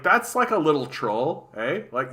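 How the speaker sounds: normal talking voice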